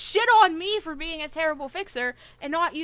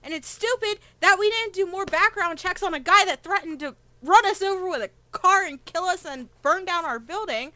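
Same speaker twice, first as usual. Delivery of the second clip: normal talking voice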